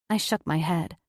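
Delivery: ordinary delivery, nothing marked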